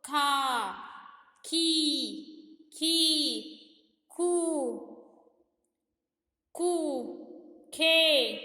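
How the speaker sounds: each syllable of the ख row called out loud and stretched; loud syllable drill. Shown as a demonstration. Teacher reciting the ख barakhadi for children, loud slow chant. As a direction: chant खा खी खू one at a time for kids. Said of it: "जैसे कि".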